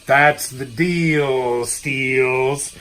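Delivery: very slow catchphrase; slow drawn-out. Pretend. Host stretching his catchphrase for viewers. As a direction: drag it out for effect.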